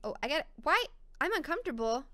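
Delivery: ordinary talk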